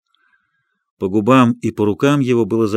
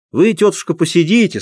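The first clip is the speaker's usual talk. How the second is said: clown voice; 4 semitones higher than usual. Acted. Clown addressing the dog onstage.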